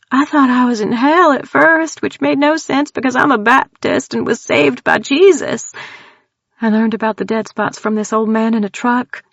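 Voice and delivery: put-on scared country girl blurting out her terror; frightened Texan woman. Tildy tells strangers about the clown, high frightened Texan voice.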